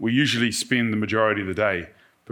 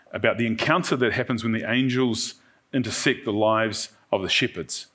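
ordinary delivery

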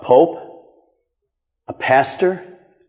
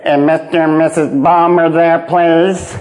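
telemarketer voice; 2 semitones lower than usual. Retold or imitated. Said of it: "And they say".

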